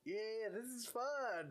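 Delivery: slow, drawn-out forced politeness; fake enthusiasm. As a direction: pretend you enjoy it, slowly. Faking enjoyment to friend.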